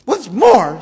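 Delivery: high, loud snap; snapped. Acted. Lorax cutting in on the Onceler, high loud snap. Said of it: "snapped the Lorax".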